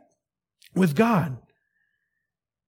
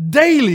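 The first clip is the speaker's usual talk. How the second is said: daily called out louder; stress on daily; 4 semitones higher, 5 dB louder than usual. Shown as a demonstration. Preacher prompting the church, daily called loud. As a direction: punch daily for the church to repeat. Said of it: "Come on, say daily".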